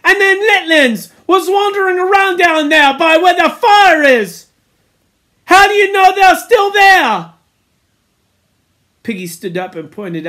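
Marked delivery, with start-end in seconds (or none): 0.0-7.3 high, loud accusing cry; alarmed angry shout. A narrator's reading. Piggy warns the boys of children near fire, loud alarm.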